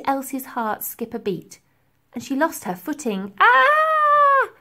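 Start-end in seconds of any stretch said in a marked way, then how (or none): 3.4-4.5 high loud shriek of falling; girl's scream. A narrator's reading. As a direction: scream as she slips. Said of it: "she screamed".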